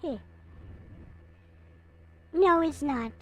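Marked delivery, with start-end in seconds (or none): none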